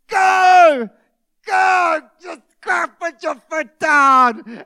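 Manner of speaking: loud, high mock yell at the slow car ahead; hammed-up road rage yelling go, go; impatient driver yelling. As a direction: shout at the granny to hurry up and drive. Impatient driver behind slow granny.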